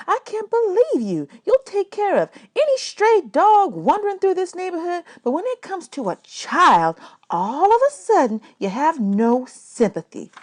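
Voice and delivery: high, indignant woman's voice; scolding woman. A narrator's reading. Miss Thomas scolding Calloway.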